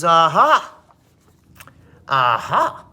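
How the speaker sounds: loud, high gotcha cry; hammed-up triumphant principal; triumphant discovery. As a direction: crow it like you caught them.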